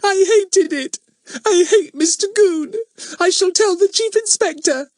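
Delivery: high, loud, tearful outburst; upset little girl crying; sobbing. A narrator's reading. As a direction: wail with childish indignation. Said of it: "sobbed Bets".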